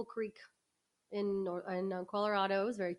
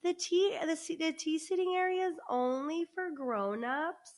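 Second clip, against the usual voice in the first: imitating the owner; 3 semitones higher than usual. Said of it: "and she's like".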